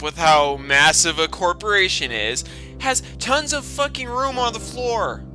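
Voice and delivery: ordinary talk, nothing marked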